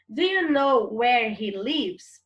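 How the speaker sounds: slow, deliberate English sentence; slow classroom reading of the English question; slow model reading. Shown as a demonstration. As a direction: read it slowly so students follow. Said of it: "teacher reads dialogue aloud".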